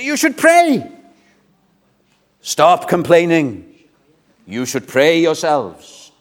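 angry rebuke. Retold or imitated. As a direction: scold them for complaining. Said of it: "He was very angry with them".

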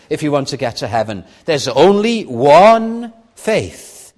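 loud pleading sermon voice; urgent preaching. Acted. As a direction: plead with them to turn back.

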